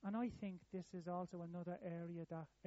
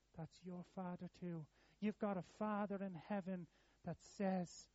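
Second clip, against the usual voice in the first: ordinary delivery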